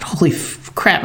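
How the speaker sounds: whispered, cut-off curse; talking in his head. Retold or imitated. Hunter spotting the other deer, whispered shock. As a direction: swear under the breath, catching the word. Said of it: "I'm talking in my head".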